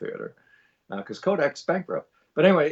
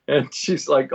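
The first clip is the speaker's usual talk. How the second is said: normal talking voice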